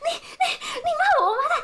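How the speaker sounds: very high, hammed-up sputtering; stammering outrage. Imitating the insulted old man, high stammering outrage.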